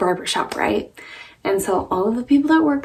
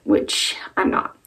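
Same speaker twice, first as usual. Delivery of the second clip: usual speaking voice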